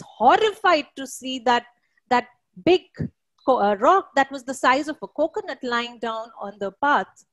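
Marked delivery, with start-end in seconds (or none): none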